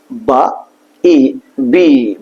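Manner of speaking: বা then ই, slowly stretched into বি; drawn-out B. Shown as a demonstration. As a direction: sound it out বা... ই... বি. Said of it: "এভাবে একটু টেনে একটু লম্বা করে পড়তে হবে".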